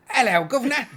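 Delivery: high, loud hammed-up Cockney greeting; over-the-top Cockney. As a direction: bellow it like a cartoon Cockney. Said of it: "But it's usually like".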